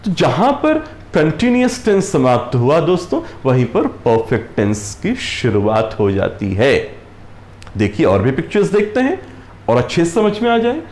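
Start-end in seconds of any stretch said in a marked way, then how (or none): none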